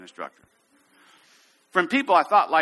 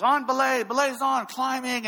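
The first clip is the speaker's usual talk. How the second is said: high, carrying rope calls; climbing calls; 10 semitones higher than usual. Shown as a demonstration. Instructor demonstrating climbing calls to students, high calls. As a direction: call it out high to your partner. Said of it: "all the calls".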